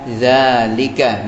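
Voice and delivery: zalika with a slow, clear voiced z; pronouncing za. Shown as a demonstration. Teacher modelling zalika for the class, slow and clear. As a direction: stretch out zalika so the z buzzes. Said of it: "kita berlatih mengatakan za".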